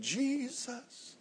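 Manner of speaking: high, far-off anguished cries of the name; screaming Jesus. Retold or imitated. Dying woman in a nursing home crying out to Jesus, high anguished screaming. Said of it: "she was screaming as loud as she could".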